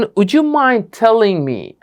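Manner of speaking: Would you mind telling me, slow and courteous; slow polite model. Shown as a demonstration. Teacher modelling the smartest polite request. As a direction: ask it gently and slowly. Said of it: "আপনি বলেন".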